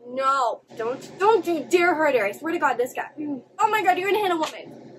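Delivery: loud, indignant outburst; outraged protest. Real, not acted. Viewer warning him not to hurt her, outraged. Outraged at man threatening a woman.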